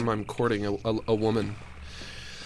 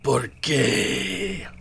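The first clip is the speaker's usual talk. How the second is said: mock lament; 5 semitones higher than usual. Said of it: "That's what I say when I walk in".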